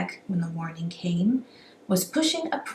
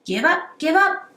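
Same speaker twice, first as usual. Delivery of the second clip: higher, louder cry of give up; robbers crying out; 9 semitones higher, 5 dB louder than usual. A narrator's reading. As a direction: yell it as a gang of robbers. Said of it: "the robbers cried".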